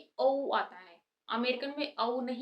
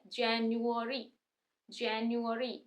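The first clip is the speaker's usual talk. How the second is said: slow, word drawn out by syllables; slow demo; much slower than usual. Shown as a demonstration. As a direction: say January slowly, sound by sound. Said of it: "अब थोड़ा जल्दी से बोलेंगे". (धीरे-धीरे, हर हिस्सा अलग)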